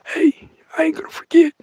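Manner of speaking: a wounded little voice complaining; whiny thumb. Retold or imitated. The thumb at night complaining it was hit, high whispery whine. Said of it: "It was like".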